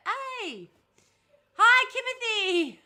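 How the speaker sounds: high, loud, slow laughing hello; excited greeting. Real, not acted. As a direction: greet a friend with delight. Greeting a viewer.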